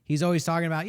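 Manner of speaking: ordinary talk, nothing marked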